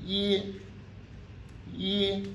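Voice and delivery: yi held high and level, first tone. Shown as a demonstration. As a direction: stretch yi out on a high flat pitch. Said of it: "c'est du I premier ton".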